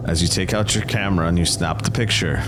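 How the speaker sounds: normal talking voice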